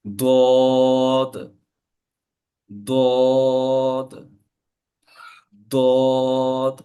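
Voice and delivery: দোয়াদ with a thick d and very long vowel; slow model pronunciation of দোয়াদ, heavily drawn out; heavy Dad. Shown as a demonstration. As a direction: sustain দোয়াদ loud and full. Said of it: "দোয়াদ উচ্চারণটা হবে ঠিক এভাবে করে".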